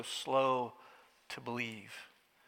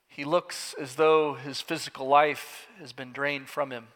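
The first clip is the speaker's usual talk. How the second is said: normal talking voice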